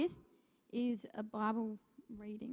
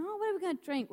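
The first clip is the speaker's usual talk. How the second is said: whiny complaining Israelites; 9 semitones higher, faster than usual. Retold or imitated. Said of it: "they're already complaining, going".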